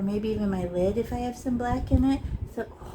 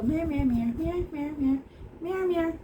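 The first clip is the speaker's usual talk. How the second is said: rising playful meows; cat noises; 6 semitones higher than usual. Pretend. Teacher making silly cat noises with each stroke. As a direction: meow like a silly cat while painting. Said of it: "Why did I say, um, cat noises?".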